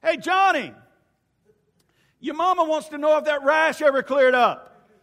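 loud, high call across a crowded room; hollering across the room. Retold or imitated. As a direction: yell it across the room to embarrass him. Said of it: "I'd holler across the room".

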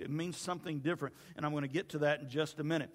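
ordinary talk

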